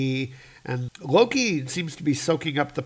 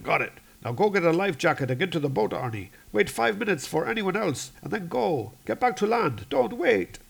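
brisk, higher-pitched orders; Brahm; 6 semitones higher than usual. Acted. Rescuer ordering crewman to the boat, urgent and higher. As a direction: take charge and send him off urgently. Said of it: "And Brahm's like".

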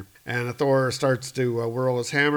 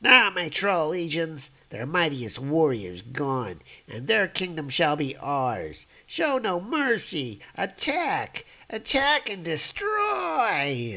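troll king's voice; 5 semitones higher, slower than usual. Acted. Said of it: "He's like".

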